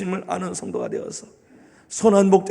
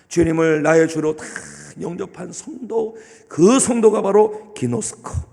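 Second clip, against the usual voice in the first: ordinary talk, nothing marked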